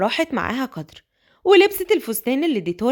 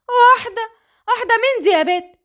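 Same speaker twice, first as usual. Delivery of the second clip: surprised; 9 semitones higher than usual. Acted. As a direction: jump at the news. Madam surprised.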